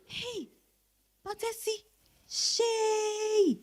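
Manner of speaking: soft, slow, swooning over his looks; a quiet, lingering swoon; admiring hush. Acted. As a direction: breathe out how handsome he is. Admiring the approaching man.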